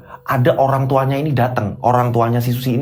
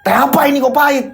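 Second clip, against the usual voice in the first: high, raised-voice outburst; angry complaint; 11 semitones higher, 4 dB louder than usual. Acted. Husband spitting out bitter tea, high angry outburst. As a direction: burst out in disgusted protest.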